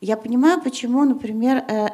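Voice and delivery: ordinary talk, nothing marked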